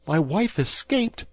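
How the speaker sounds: high, slow stunned question; high disbelieving cry from a startled husband; shocked cry. A narrator's reading. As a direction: gasp it out in disbelief. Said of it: "cried Bonacieux".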